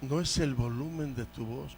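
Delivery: soft, low repetition; quietly, letting it sink in; hushed reminder. Real, not acted. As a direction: drop the voice low to make the point. Repeats his point softly.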